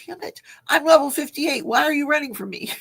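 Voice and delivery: retold exasperation. Retells yelling at runaway game cow.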